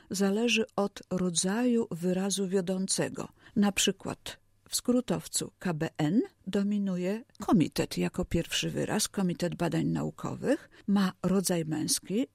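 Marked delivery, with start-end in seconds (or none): none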